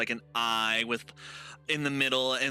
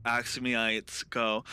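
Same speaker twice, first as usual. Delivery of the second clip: ordinary talk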